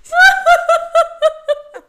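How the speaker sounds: high, loud, helpless laugh; shrieking laugh. Real, not acted. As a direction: laugh until you can barely breathe. Friend doubled over laughing.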